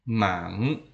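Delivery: "Mang" with the pitch rising to the end; rising-tone "Mang" for learners; second tone. Shown as a demonstration. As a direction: lift "Mang" upward like a question. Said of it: "bunyi kedua".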